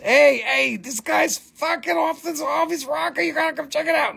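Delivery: high, eager gossip voice; excited friend. Pretend. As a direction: call your friends over, hyped. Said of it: "go tell all your friends".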